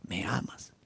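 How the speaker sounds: soft, breathy whispered question. Acted. Jesus asks Peter a second time, whispered. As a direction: whisper it intimately.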